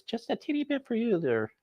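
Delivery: high sing-song coo for the runt dog; baby talk to a dog. Acted. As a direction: coo it to the little runt like a puppy. Talking to the dog.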